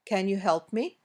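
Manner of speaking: yes-no question rise on "help me"; rising request intonation. Shown as a demonstration. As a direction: lift the voice on "help me". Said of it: "These usually go up at the end".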